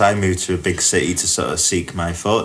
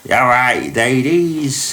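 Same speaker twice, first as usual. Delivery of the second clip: lightly done Cockney greeting, a little higher; a hint of a cheeky Cockney chatting up ladies; Cockney impression; 4 semitones higher, 3 dB louder, slower than usual. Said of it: "in a really thick Cockney accent".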